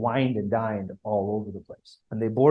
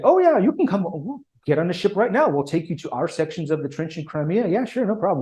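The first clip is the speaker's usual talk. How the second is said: high, eager and much louder, breezy welcome; cheery mock British offer; 5 semitones higher, 3 dB louder than usual. The British eagerly inviting the American commission, high breezy mock voice. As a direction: toss off the invitation like an overkeen host. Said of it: "the British say".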